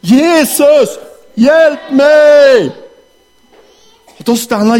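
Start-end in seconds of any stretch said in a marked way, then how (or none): 0.0-2.7 high and loudest cry for help; louder shout. Acted. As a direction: shout as loud as you can. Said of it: "En gång till".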